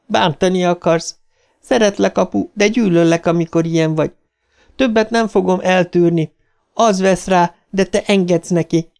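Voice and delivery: put-on high-pitched girl standing up to her father; defiant, hurt daughter. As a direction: confront him in a sustained high voice. (magas hangú, dacosan szembeszálló lány)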